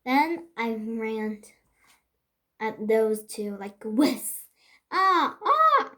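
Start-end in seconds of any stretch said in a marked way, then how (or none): 4.9-5.9 high, loud screech. Acted. Child scaring trick-or-treaters, high screech. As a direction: shriek to scare the girls. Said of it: "I screeched".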